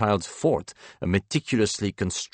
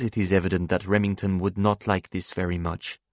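ordinary delivery, nothing marked

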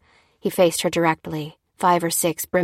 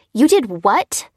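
higher, louder angry disbelief; a wife's indignant, higher, louder protest; seething; 10 semitones higher, 6 dB louder than usual. A narrator's reading. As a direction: bristle at him in outrage. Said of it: "seethed Lucy".

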